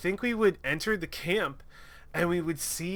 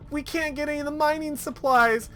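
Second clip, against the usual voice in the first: mock pleading; 10 semitones higher than usual. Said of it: "begged and pleaded and cried".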